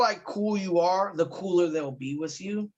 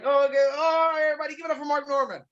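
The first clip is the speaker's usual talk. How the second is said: high, fast rushed host intro; the host hurrying to bring on the headliner, high and rushed; hurried emcee; 9 semitones higher, much faster than usual. Retold or imitated. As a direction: race through it like a flustered emcee. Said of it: "he was like".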